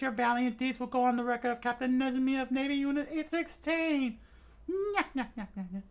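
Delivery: high, squeaky gloating with nyak laugh; smug rat captain. Acted. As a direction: gloat like a greedy little rat.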